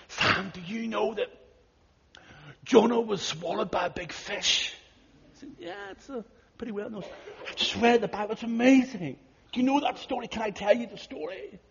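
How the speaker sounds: high, eager voice of a new believer; eager retelling. Retold or imitated. Sagami telling pastor Sam about Jonah, high and eager. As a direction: bubble over with the news of Jonah.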